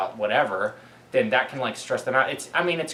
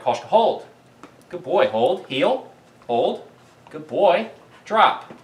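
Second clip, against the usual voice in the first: loud, higher commands to a dog; firm dog commands; 5 semitones higher, 4 dB louder, slower than usual. Real, not acted. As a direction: call each command out crisply to the dog.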